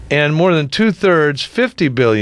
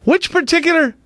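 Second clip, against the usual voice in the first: high, incredulous repeated questions; can't believe what he just heard; shocked disbelief; 12 semitones higher than usual. Real, not acted. Host shocked by a reader wishing Bush a painful death, high disbelief.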